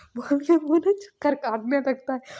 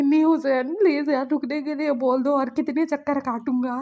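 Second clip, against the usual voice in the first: higher, louder desperate plea; worn-out boy pleading loudly with his friend; loud begging; 4 semitones higher, 5 dB louder than usual. Acted. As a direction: beg loudly to be let off the laps. Exhausted member begs to stop.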